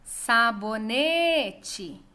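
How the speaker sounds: "Sabonete" called slowly, NE drawn out; stress on NE. Shown as a demonstration. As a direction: hold a long NE in "Sabonete". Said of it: "Ne é a sílaba tônica". (chamando "Sabonete", com o NE bem alongado)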